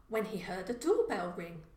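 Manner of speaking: plain reading voice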